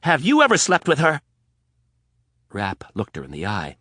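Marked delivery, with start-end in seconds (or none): 0.0-1.2 high, loud pointed question; angry demand. Acted. Anna demanding the truth from Rapp, loud. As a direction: stress on ever.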